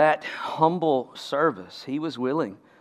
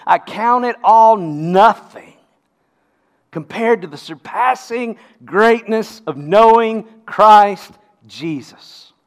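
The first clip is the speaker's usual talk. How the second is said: loud, raised, emphatic declaration; very powerful; 6 semitones higher, 10 dB louder than usual. Acted. Preacher quoting Paul's testimony to the congregation, very powerfully. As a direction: proclaim it with force, drawing out key words. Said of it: "he says very powerfully".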